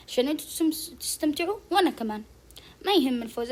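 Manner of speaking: ordinary delivery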